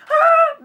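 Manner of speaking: very high, loud delighted shriek. Real, not acted. Excited reaction to box.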